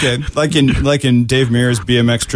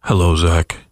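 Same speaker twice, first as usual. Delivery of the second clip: godlike voice; 6 semitones lower than usual. Shown as a demonstration. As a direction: greet him from the heavens. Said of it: "let me try and sound more godlike".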